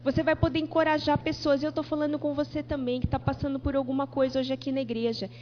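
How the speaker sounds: normal talking voice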